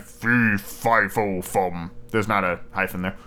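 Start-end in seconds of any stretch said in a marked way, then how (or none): 0.0-1.9 giant's voice. Acted. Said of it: "The giant cried".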